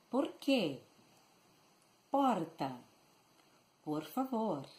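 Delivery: "Por quê, Porta, Por favor" with tongue-tip R; trilled R. Shown as a demonstration. Teacher leading practice of "Por quê, Porta", tongue-tip vibrating R. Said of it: "Let's practice one more time".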